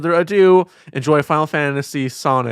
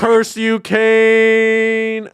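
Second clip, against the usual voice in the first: dramatic shout; 6 semitones higher, much slower than usual. Acted. Villain line in game, shouting.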